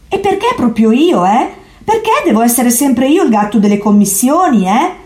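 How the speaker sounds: secretary cat protesting the errand, indignant. A narrator's reading. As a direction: grumble that it's always you. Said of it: "protestò segretario".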